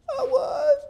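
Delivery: put-on very high child voice; tearful, drawn-out little squeak; whimpering boy. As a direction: imitate a kid sniffling in surprise. Boy realizes he was close.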